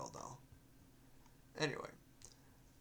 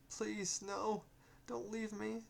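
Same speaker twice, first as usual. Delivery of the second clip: high, desperate pleading spirit voice; pleading ghost; 10 semitones higher, slower than usual. Acted. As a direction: beg him not to go, voice pitched up. Ghost begs again.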